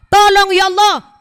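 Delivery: a loud, desperate plea to God; loud pleading cry. Acted. Preacher voicing a desperate plea to God, loud. Pleading prayer voiced in sermon.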